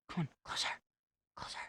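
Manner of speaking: hushed, voiceless urging; soft, playful whisper; whispered coaxing. Pretend. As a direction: whisper it as if luring someone in. Coaxing the game monster closer.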